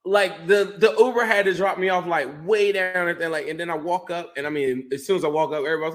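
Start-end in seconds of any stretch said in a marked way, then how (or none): none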